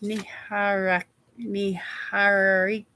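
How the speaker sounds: Niharika sounded out slowly, syllable by syllable. Shown as a demonstration. Host carefully sounding out a viewer's hard name slowly. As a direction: say Niharika slow so you get it right. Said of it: "I have to say it slow".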